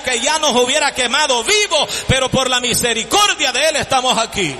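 full-force pulpit preaching; fiery preaching. Acted. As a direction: preach it out to the congregation. Preaching climax, Gloria a Dios.

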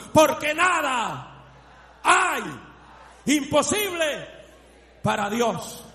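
slow, high, loud proclamation; fervent call-and-response shout; shouted declaration. Acted. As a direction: belt each word out for the church to repeat. Leads congregation in declaration.

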